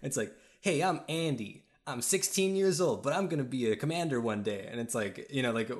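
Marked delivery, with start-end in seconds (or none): 0.6-4.7 hammed-up chipper young commander, pitched high; plucky Andy. Introducing himself as a teen officer, mock plucky. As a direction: put on a plucky, happy game-hero voice. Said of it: "plucky and happy all of the commanding officers are".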